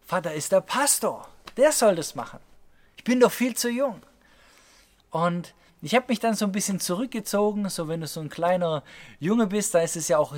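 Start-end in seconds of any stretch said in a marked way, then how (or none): 0.0-4.0 put-on rising into a high boyish protest; protesting young boy. Boy objecting that his pastor father should do it, protesting. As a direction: plead your way out, pitch climbing.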